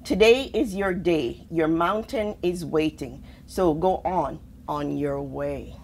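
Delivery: usual speaking voice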